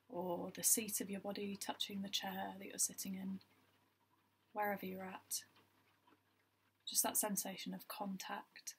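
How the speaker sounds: even, unhurried meditation guide with long pauses; guided meditation. Acted. Guiding listeners through a body scan, calm meditation voice. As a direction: lead gently in short phrases, leaving space between them.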